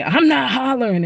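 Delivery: imitating him loudly claiming he isn't yelling; retold loud denial. Angry blind man at restaurant denies hollering, mock yell. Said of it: "and he be so loud".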